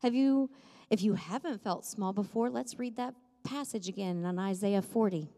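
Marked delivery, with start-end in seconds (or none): none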